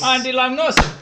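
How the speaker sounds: high, loud sing-song call; sing-song greeting. Pretend. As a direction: echo it as a cheery greeting. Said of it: "Like that?".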